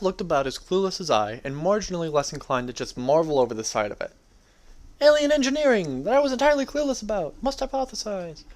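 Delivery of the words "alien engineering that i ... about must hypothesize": high, bright mock-scientist outburst; squeaky excited inner voice; excited exclamation. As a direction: blurt it out like a giddy nerd. Narrator voices excited inner thought.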